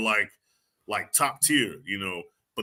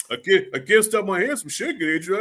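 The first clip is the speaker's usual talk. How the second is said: mock trembling Rocky; 4 semitones higher than usual. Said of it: "starts out with him trembling".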